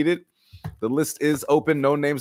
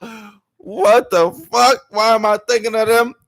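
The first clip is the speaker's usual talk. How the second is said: loud, much higher, laughing disbelief; flustered outburst; 10 semitones higher, 6 dB louder than usual. Retold or imitated. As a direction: burst out half-laughing. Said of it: "you're like".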